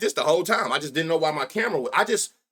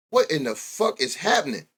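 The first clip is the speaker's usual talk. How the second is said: normal talking voice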